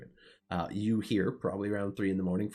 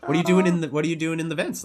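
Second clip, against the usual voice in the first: raised pitch, loud, puzzled demand; loud questioning npc; 7 semitones higher, 7 dB louder, faster than usual. Acted. Innkeeper loudly questioning the sneaking player. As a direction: call out the question like a startled innkeeper.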